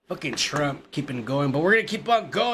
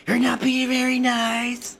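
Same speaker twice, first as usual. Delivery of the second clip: higher, slow, mock-scolding Trump; hammed-up Trump scolding; Trump impression; 7 semitones higher than usual. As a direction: drag it out, teasing. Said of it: "My, my Trump is bad".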